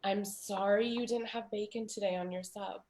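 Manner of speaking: soft, measured consoling; gentle, careful sympathy. Retold or imitated. Mother consoling daughter about missing bacon, gentle. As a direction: let the volume drop and console carefully. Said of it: "it's the way that you have to talk to people".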